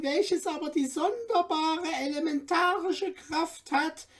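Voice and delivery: high, eager little dwarf guide; courteous little dwarf. A narrator's reading. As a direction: play the tiny helpful dwarf showing off treasures. Dwarf explains the magic water.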